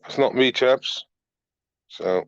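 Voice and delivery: usual speaking voice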